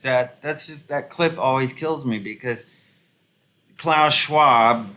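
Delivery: ordinary talk, nothing marked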